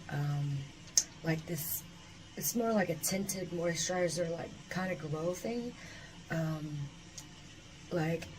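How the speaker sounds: ordinary talk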